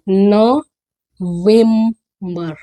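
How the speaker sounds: नवंबर very slow, "no... vem... buh"; syllable-by-syllable pronunciation. Shown as a demonstration. Teacher drilling November, syllable-by-syllable pronunciation. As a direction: split नवंबर into three slow pieces. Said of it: "क्या है ये".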